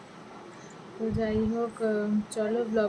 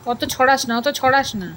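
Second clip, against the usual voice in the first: scolding the dog; 5 semitones higher, 9 dB louder than usual. Real, not acted. Scolding the dog while bathing.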